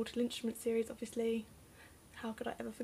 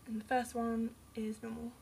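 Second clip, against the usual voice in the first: a soft, whispered side remark; hushed aside, voice dropped; 6 dB quieter than usual. Real, not acted. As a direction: whisper it like a private note. Aside about book sizes.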